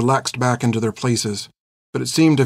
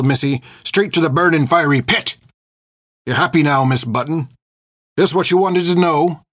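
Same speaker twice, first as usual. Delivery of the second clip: put-on old pirate's angry, rolling tirade; raging boast; 3 semitones higher than usual. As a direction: build to a bitter challenge. Brandishes gun, rages at her.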